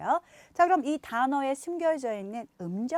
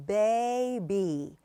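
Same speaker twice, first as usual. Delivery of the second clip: 베이비 slow, 베 long and 이비 soft; 베이비 with a long first beat; long first, soft second; much slower than usual. Shown as a demonstration. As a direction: stretch 베 in 베이비, then fade. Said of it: "앞에 좀 길게 하고".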